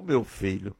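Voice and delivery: low, soft father's voice; hushed, lightly voiced father; moved father. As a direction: answer the child tenderly, low and quiet. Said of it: "Aí o pai chegou".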